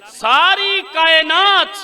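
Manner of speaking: slow, high, loud declaration; preaching at full force. Acted. Preacher proclaiming the imam's saying to the gathering, full force. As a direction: ring out each word to the hall. Repeating phrase for emphasis.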